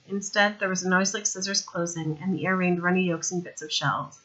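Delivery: ordinary delivery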